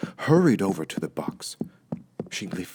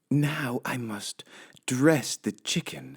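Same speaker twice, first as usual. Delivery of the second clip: Amelia Bedelia voice; 7 semitones higher than usual. Acted. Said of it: "said Amelia Bedelia".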